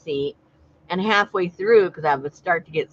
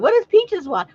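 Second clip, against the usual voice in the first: staff cooing at the parrot; 13 semitones higher, 5 dB louder than usual. Retold or imitated. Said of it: "And they said".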